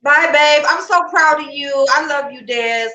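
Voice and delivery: high, loud, gushing goodbye; excited goodbye. Real, not acted. Host gushes goodbye to her proud friend, excited. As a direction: beam it out, bursting with pride.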